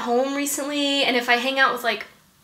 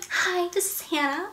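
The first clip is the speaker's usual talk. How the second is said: mom's mock of an accentless American voice; softer, higher, carefully neutral American voicemail accent; mocking non-accent; 5 semitones higher, 6 dB quieter than usual. Mom mocks daughter's professional voicemail greeting, put-on non-Southern accent. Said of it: "mocks my non-accent".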